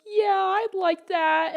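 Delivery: put-on slow, high old-man reply; John Miller. Old regular happily taking the soup, slow sweet voice. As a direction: imitate a sweet elderly man, unhurried. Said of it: "a really sweet old man".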